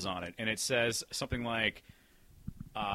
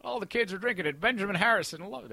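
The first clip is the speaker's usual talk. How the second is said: put-on higher, louder old-timey huckster; old newsreel narrator; 9 semitones higher, 5 dB louder than usual. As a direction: sell it like a newsreel hawker. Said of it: "the old-timey voice".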